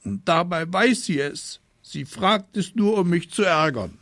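put-on high, whining child's protest; hammed-up aggrieved whine; tearful complaint. A narrator's reading. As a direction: keep blubbering the accusation to mum. Boy still crying, complaining to mother.

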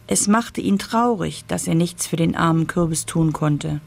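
ordinary delivery, nothing marked

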